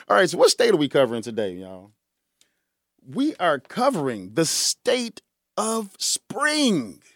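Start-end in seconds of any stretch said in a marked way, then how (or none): 3.0-7.0 slow, drawn-out, higher-pitched reveal; dramatic announcement. Real, not acted. Host reveals today's topic to listeners, slow dramatic announcement. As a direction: announce it grandly, word by word.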